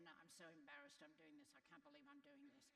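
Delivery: quick, soft, self-conscious mutter; embarrassed mumble. Shown as a demonstration. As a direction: say it like you're ashamed to be doing it. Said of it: "you're not gonna say it in".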